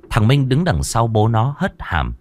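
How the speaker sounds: ordinary delivery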